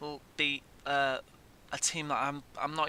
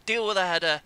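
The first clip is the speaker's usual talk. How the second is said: louder, higher exasperated yell; exasperated shout; 5 semitones higher, 8 dB louder, faster than usual. Real, not acted. Gamer shouting at his defenders to clear a header, loud exasperation. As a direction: bark the order at the defenders.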